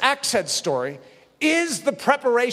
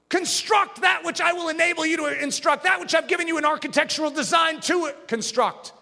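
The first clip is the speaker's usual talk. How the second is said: commanding Jesus; 9 semitones higher than usual. Retold or imitated. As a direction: order them to take what is theirs.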